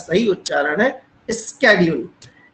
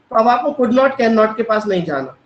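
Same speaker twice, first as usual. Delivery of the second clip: normal talking voice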